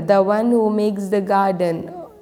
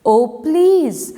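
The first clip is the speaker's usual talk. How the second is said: higher pleading voice; worm begging; 5 semitones higher than usual. Acted. Worm begging the mango, higher pleading tone. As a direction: plead to be allowed in. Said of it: "begged the worm".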